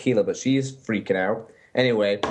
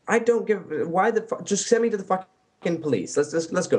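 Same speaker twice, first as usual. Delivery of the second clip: exasperated, pitched-up rant; mock angry caller; 7 semitones higher than usual. Caller ranting at the 911 dispatcher, mock exasperated. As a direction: act out impatient anger at dispatch. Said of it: "the conversation goes something like this".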